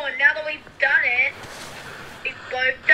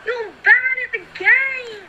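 frustrated yell; 12 semitones higher than usual. Real, not acted. Yelling at teammate.

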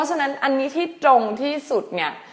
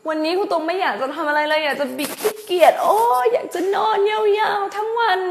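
lazy whine; 9 semitones higher than usual. Pretend.

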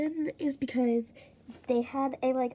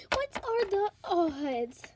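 usual speaking voice

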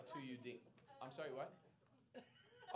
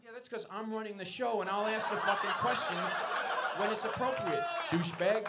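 mock indignation; 5 semitones higher, 7 dB louder than usual. Comic snaps back at heckler.

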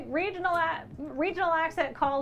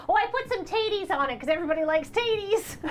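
higher-pitched Minnesota lady voice; Minnesota lady accent; 5 semitones higher than usual. Acted. Showing off a lady from Minnesota with a hot dish, Minnesota accent. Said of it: "lady from Minnesota with a hot dish".

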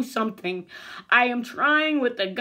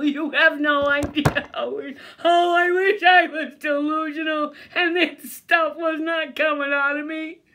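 loud, raised-pitch anguished rant; exasperated outburst; 4 semitones higher, 4 dB louder than usual. Real, not acted. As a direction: cry out in frustration at being disbelieved. Exasperated at being disbelieved.

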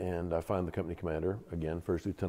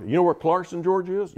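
loud, high imitation of a Southern officer; strong Southern accent; 11 semitones higher, 7 dB louder than usual. Commander quizzing a fellow Georgian, Southern accent. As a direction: quiz him loudly in a Southern accent. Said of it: "in a real strong Southern accent".

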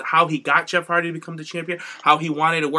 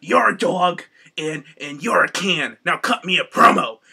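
Vince McMahon voice; 7 dB louder than usual. Retold or imitated. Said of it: "does the Vince McMahon voice".

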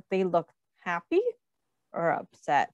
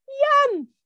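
very high, gleeful yum; delighted yum; 3 dB louder than usual. Acted. Customer tasting the pizza, high delighted squeal. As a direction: savor it with a squeal of joy. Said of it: "This person said".

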